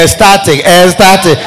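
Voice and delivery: "ecstatic" with the k dropped, as es-tatic; wrong pronunciation. Shown as a demonstration. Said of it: "It's not ecstatic".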